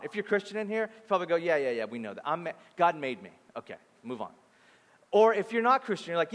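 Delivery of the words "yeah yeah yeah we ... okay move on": acted impatient shrug in the voice; mock dismissive. Acting bored listener brushing it off.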